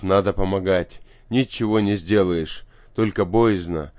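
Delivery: ordinary delivery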